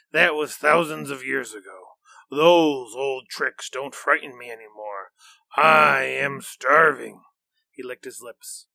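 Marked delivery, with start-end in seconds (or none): 0.1-7.2 louder, menacing monster voice, slowing on the last words; a greedy beast who is done being fooled; hungry monster. Acted. Monster Nyan threatens Xingling, louder and hungry.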